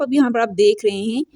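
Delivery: usual speaking voice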